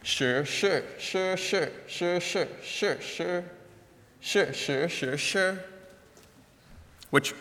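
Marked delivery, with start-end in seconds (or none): 0.0-5.6 each sure on its own Mandarin tone, high then rising then falling; the tongue twister poem read aloud in Mandarin tones. Shown as a demonstration. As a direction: chant the shi syllables through their tones. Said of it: "the first two lines read".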